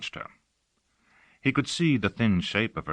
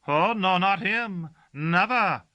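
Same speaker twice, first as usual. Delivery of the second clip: servant Gossagor's voice; 12 semitones higher, 4 dB louder than usual. Acted.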